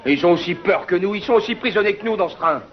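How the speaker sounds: high, loud insistent argument; heated shouting. Acted. Heated argument among prisoners.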